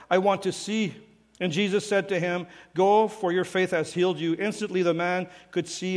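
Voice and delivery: calm scripture reading. Acted. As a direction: read the passage to the congregation. Reads Bible passage aloud.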